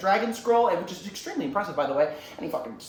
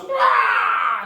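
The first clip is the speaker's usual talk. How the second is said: loud roaring battle cry; big drawn-out "rah" roar; Ian McShane yell; 3 semitones higher, 8 dB louder than usual. Retold or imitated. Villain yells as he throws the sword, roaring. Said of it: "does that Ian McShane yell".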